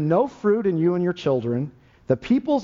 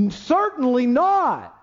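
drawn-out outraged protest; crowd yelling out; 7 semitones higher, slower than usual. Retold or imitated. Preacher mimicking the shocked crowd's slow, raised outcry. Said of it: "They started all yelling out".

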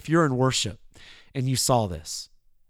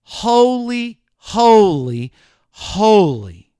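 ringing, louder triple call; rising first holy, then louder and falling; angelic cry; 5 semitones higher, 6 dB louder, much slower than usual. Retold or imitated. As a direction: call it out like the cherubim. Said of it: "begin crying out to one another".

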